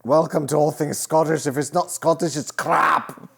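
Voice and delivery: high, loud mock Scottish accent; Scottish character voice. Quoting the All Things Scottish character, mock Scottish accent. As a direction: ham up a Scottish shopkeeper. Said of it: "Talks the same way".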